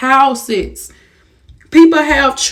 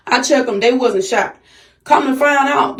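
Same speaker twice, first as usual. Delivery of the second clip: usual speaking voice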